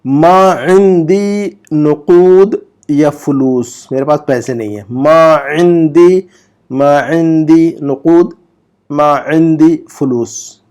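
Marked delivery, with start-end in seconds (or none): 0.0-3.9 मा इंदी नुकूद या फुलूस stretched out very slowly; very slow model reading. Shown as a demonstration. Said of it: "मैं pause देकर". 4.9-10.6 मा इंदी drawn out slowly and a little louder; slow model reading. Shown as a demonstration. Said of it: "मैं pause देकर".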